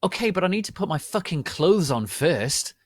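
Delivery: high, loud angry shout. Acted. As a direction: snap at them to wait. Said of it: "I shouted angrily".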